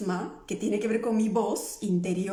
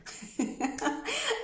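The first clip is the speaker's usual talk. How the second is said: a long high quiet giggle; high soft giggle; 11 semitones higher, 4 dB quieter than usual. Real, not acted. Laughing at herself for mixing things up, high giggle. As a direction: giggle softly, way up.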